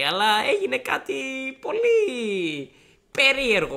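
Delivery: high, stretched mimicking voice; imitated grave headmaster. Headmaster reports something very strange, high drawn-out impression. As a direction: build it up in a high put-on voice. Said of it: "μου λέει".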